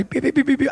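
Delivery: high, rapid imitated radar beeps; radar beeping. Preacher voicing the Holy Ghost radar, rapid beeping. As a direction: imitate an alarm going off, quick high beeps. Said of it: "your radar gonna say".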